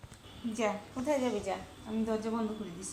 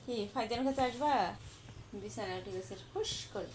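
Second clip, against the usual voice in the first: ordinary talk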